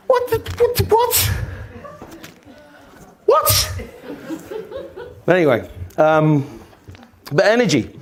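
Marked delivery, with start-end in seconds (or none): 0.1-3.7 high, stuttering incredulity; acting out being lost for words; baffled disbelief. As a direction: stammer it in high-pitched bewilderment. Retold stammering bafflement after row.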